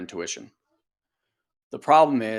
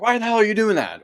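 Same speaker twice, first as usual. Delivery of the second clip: higher and louder exasperated snap; mock snap at kid; 10 semitones higher, 4 dB louder, much faster than usual. Parent turning on their kid, higher and louder mock snap. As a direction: bark it straight at the kid. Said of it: "And then it's like".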